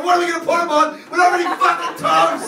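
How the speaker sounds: loud, high furious rant at the wife; angry rant. Retold or imitated. Husband ranting at wife Jessica over toast, loud shout. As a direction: yell it like the toast is everything.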